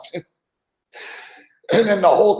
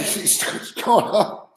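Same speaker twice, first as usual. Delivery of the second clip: ordinary delivery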